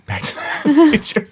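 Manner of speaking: high, laughing through the title. Real, not acted. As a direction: giggle out the movie name. Laughing at the suggestion.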